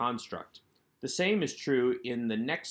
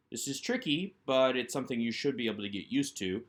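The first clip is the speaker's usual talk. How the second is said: ordinary talk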